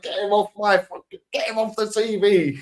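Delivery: put-on high-pitched protesting girlfriend; put-on high voice, complaining; mock girlfriend complaint. Girlfriend's objection, acted out.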